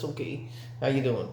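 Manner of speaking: understated, soft reply; low-key greeting. Retold or imitated. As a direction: toss off the hello quietly in your own pitch. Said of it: "I was like this".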